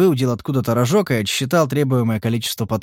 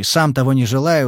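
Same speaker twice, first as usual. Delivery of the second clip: plain reading voice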